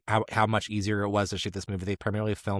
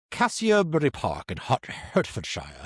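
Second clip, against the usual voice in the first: put-on British accent, "Hertfordshire" as HART-fuhd-shire; lightly hammed English accent on the place name; 5 semitones higher than usual. Host attempting the place name in a British accent. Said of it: "I'm gonna do the accent this time".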